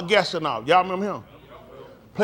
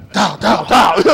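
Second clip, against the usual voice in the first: calling out; 8 dB louder, slower than usual. Retold or imitated. Calling a play, shouting.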